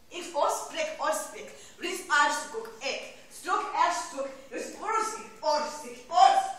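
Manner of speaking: high, loud volley of alien words; forceful invented-tongue declamation. Acted. Rose Lalonde denying coldness in a strange tongue, declaimed. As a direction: build the strange phrases to a loud peak.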